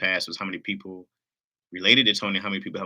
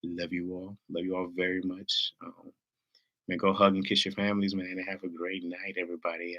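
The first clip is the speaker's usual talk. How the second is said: ordinary talk, nothing marked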